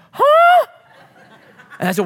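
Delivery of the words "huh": loud, very high mock gasp; exaggerated cry of disbelief; hammed-up scandalized shriek; shocked gasp. Retold shocked reaction to child's defiance.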